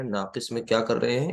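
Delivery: ordinary delivery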